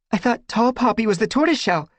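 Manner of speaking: high, gasping worried line. A narrator's reading. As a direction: blurt it between gasps. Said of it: "Squirrelpaw gasped".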